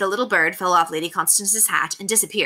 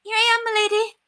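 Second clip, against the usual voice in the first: very high, squeaky servant voice; squeaky housemaid. A narrator's reading. Housemaid answering her lady's summons, high squeak. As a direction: pipe up, squeaky as a fiddle. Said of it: "with her high voice squeaky as a fiddle".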